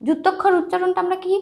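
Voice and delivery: ordinary talk, nothing marked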